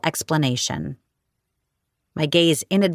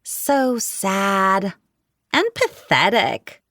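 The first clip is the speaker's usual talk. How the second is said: catty falsetto; 3 semitones higher, much slower than usual. A narrator's reading. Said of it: "tisked at me".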